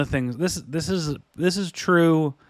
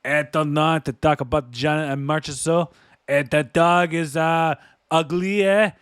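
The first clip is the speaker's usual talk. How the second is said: hammed-up French Canadian hockey player; French Canadian accent. Joking about a player, hammed-up French Canadian accent. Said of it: "put on the French Canadian accent".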